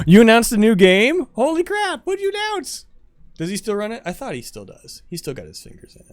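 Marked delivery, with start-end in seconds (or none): 0.0-2.9 louder and higher, gasp then blurt it out; floored by big news; gasp, then a loud excited holy crap; gasping surprise. Real, not acted. Hears of a new game.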